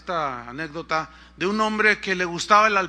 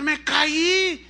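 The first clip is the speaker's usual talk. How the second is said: high acted holler from below; calling back up; 8 semitones higher, 4 dB louder than usual. Fallen man to his would-be rescuer, calling back up. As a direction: answer as a stranded climber calling up.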